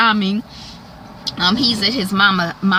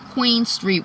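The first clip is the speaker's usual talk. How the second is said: normal talking voice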